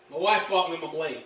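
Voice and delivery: normal talking voice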